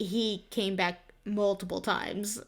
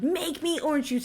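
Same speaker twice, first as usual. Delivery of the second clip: mock yell; 6 semitones higher than usual. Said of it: "He just kept yelling".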